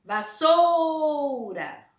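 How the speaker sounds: Vassoura with SOU drawn out high and loud; stress on SOU. Shown as a demonstration. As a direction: call out Vassoura, holding SOU. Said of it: "Chama sempre a palavra assim em voz alta".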